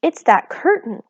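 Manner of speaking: mild alarmed cry; cried out. A narrator's reading. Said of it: "cried Homily".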